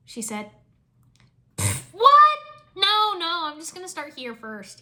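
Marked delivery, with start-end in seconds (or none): 1.6-4.8 fake innocent. Caught, acting innocent.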